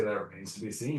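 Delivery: understated, noncommittal character answer; neutral, non-responsive. Acted. Player dodging a character's question, neutral and non-responsive. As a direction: give away nothing in the delivery. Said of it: "I say totally neutral, non-responsive statement".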